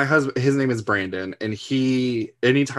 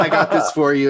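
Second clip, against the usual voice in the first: laughing sweetly; 5 semitones higher, 7 dB louder than usual. Retold or imitated. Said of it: "be like".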